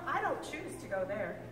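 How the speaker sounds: ordinary delivery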